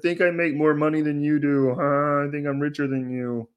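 a lightly voiced Ray Romano boast; Ray Romano bragging. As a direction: laugh through a smug brag as Ray. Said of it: "he's like".

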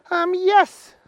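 high, loud little policeman voice; Willie the policeman. Acted. Policeman Willie stopping the humans, high and loud. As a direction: bark orders as a tiny policeman. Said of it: "said Willie".

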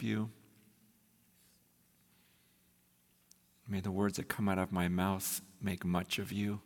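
low, soft, unhurried prayer voice; quiet prayer. Acted. Pastor praying softly over the congregation before preaching, hushed prayer. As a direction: pray it low and hushed, pauses between phrases.

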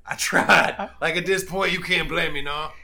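hammed-up self-defence; acted loud protest. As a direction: plead your case loudly in character. Acted excuse after abandoning Roger.

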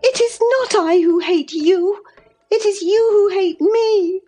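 high-pitched tearful protest; weeping. A narrator's reading. Mistress accusing her cousin of hating her, tearful and high. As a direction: wail it high, upset. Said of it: "wept Cathy".

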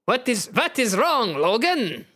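put-on high, slow accented character; questioning Nightcrawler. Nightcrawler asks his team captain, in a high accented voice. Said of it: "you ask".

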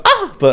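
very high squeaky dolphin cry; dolphin squeak. Acted. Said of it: "I was gonna try and do like".

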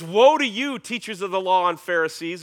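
loud, high condemning cry; Jesus raising his voice. Acted. Jesus denouncing the Pharisees on the temple steps, loud. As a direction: thunder the woe at them. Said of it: "he's gonna raise up his voice".

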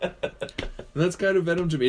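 ordinary talk

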